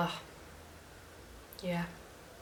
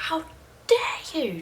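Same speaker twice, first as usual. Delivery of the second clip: high, breath-filled imitation yell; mock shout; 11 semitones higher, slower than usual. Roommate shouting at the one who left the note, mock yell. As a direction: mimic her outraged shouting, voice half held back. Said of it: "Just shouting at me".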